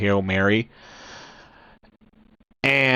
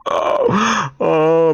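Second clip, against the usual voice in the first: imitating crying; 5 semitones higher than usual. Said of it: "He's like".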